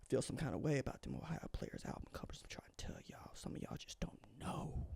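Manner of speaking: soft whispered aside. Real, not acted. Host confiding to viewers, whispering. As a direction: lean in and whisper it. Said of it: "sly whispered aside to viewers".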